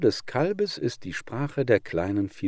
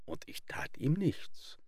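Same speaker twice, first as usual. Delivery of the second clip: whispered confession; 6 semitones higher, 9 dB quieter than usual. Acted. Wolf confessing his restraint.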